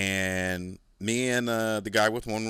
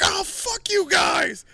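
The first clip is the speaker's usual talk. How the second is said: put-on much higher, loud drunken shout, slow; drunk yelling; 6 dB louder, slower than usual. Drunk Marine yelling at his friends, loud slurred shout.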